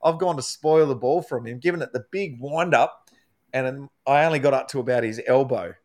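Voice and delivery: usual speaking voice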